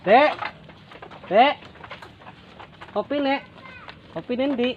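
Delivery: high shout to the house; calling out. Real, not acted. Calling child to bring coffee.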